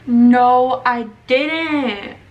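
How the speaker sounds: sudden loud dismayed no; loud shocked no. Real, not acted. Shocked denial of the mistake.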